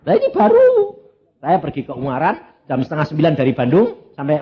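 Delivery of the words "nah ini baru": excited. Real, not acted. Preacher starts a new story.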